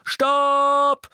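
high, very loud command; thundering shout. A narrator's reading. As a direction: thunder it across a hall. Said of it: "donnerte Harry".

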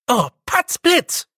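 high, slow, shouted exclamation. A narrator's reading. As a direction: cry it out high and long.

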